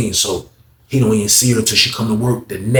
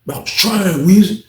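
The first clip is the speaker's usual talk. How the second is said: high mock-upset plea; imitating tearful protest; 6 semitones higher than usual. Inmate tearing up to his friend Weezy, imitated upset. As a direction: plead in a higher voice as the upset inmate.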